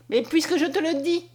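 excited insistence; exclaiming. A narrator's reading. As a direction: cry it out, eager to convince. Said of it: "s'écria Conscience".

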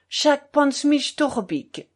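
high, slow, indignant outburst; flaring up. A narrator's reading. Offended Wróblewski rounds on Kalganov, flaring up. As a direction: lose your temper, drawing out each word. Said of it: "s'emporta à son tour Wroblewski".

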